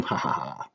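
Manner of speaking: low, very soft imitation laugh; mock laughter. Teacher imitates students laughing at her drawing, mock laughter. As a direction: imitate a quiet, spoken chuckle. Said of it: "probably laughing at me at home".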